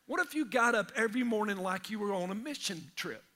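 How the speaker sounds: normal talking voice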